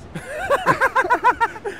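high-pitched laughing fit; burst of laughter. Real, not acted. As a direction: crack up at your own joke. Laughs at own meme joke. (carcajada aguda)

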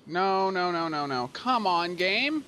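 protest, ending on a high rising plea; exasperated protest. Real, not acted. Player pleading with the game to behave, exasperated.

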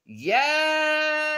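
high, loud, drawn-out cheer; excited cheer. Real, not acted. Cheering a viewer's home town.

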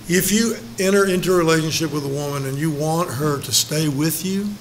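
ordinary talk

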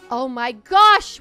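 loud, slow, thrilled gasp of joy; drawn-out, delighted oh my gosh; excited. Real, not acted. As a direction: squeal it out like your dream came true. Accepted to Unicorn Academy.

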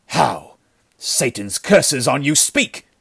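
impatient curse climbing high at the end; savage demand. A narrator's reading. Chief shaking the survivor, savagely demanding. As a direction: force the answer out of him. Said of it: "shook the man savagely".

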